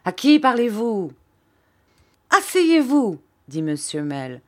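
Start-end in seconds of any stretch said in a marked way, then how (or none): 0.0-1.1 loud, high demand; indignant challenge. A narrator's reading. Red-faced pupil challenging his teacher, indignant. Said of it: "dit Steerforth en devenant très rouge". 2.4-3.2 high, loud command; sharp order. A narrator's reading. Teacher ordering a defiant pupil to sit, sharp shout.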